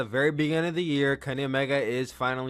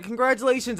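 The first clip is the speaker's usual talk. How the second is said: innocent cheer; 9 semitones higher than usual. Retold or imitated. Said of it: "He's just like".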